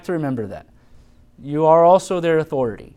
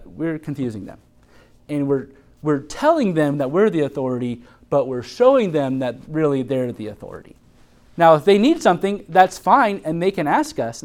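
ordinary talk, nothing marked

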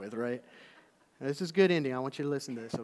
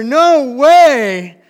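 loud, high mock shout; 9 semitones higher, 21 dB louder than usual. Preacher replaying the boy's shout, loud and high. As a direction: replay the boy's yell full blast.

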